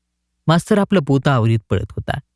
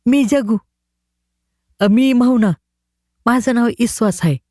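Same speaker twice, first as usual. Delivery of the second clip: put-on higher boys' voices; village boys; 8 semitones higher than usual. A narrator's reading. The boys tell the collector their names in higher voices. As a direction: answer shyly one after another.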